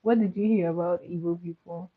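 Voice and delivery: ordinary delivery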